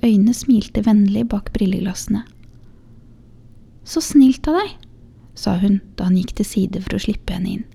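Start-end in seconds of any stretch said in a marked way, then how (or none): 3.8-4.8 put-on grateful young woman. Young mother thanks the shoemaker.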